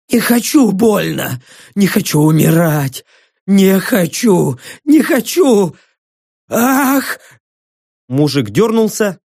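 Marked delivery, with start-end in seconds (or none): 0.1-7.2 fearful cries climbing high into a gasp; plead, each refusal more frantic; panicked pleading. Acted. Prisoner dreads a painful death, panicking.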